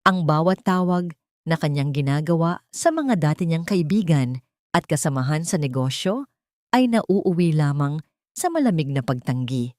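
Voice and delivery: usual speaking voice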